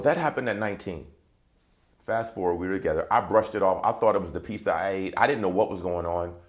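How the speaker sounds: usual speaking voice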